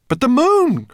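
high, loud cry of alarm; desperate cry. A narrator's reading. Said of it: "cried Ben desperately".

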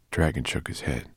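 plain reading voice

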